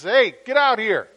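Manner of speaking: loud call to the kitchen; yelled call. Retold or imitated. Owner yelling to his wife in the kitchen, loud call. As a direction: shout it out to the back room. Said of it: "he yelled out through the kitchen".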